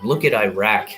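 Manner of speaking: ordinary talk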